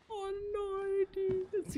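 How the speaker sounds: high, pained dismay; dismayed whine. Real, not acted. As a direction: whine it in dread. Dreads the new game ability.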